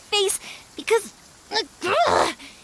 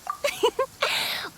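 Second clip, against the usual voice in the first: light laugh; 7 semitones higher, 4 dB quieter than usual. Real, not acted. Laughs after speech.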